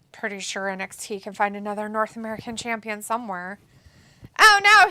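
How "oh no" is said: higher, louder alarm. Real, not acted. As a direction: wince out loud at the hit. Wince at painful move.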